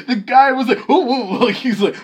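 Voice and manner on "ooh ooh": high, loud mock "ooh" cries; startled yelps. Imitating guy nipped by dog, startled yelps. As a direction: yelp twice in imitated alarm.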